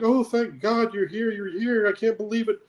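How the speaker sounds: put-on frantic mom finally finding her kid; worried mother, high and breathless with relief; relieved mother. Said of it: "She's like".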